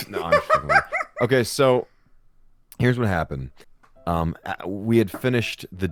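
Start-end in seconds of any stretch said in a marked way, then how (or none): none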